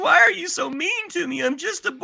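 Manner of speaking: mock boy actor pleading with the director, high and whiny; whiny little boy. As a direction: whimper like a scolded kid. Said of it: "S0 voicing the boy actor".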